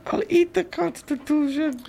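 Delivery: squeaky high hammed-up villain line. Quoting a movie villain to a friend, high squeaky villain voice. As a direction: pitch it way up as a cartoon villain.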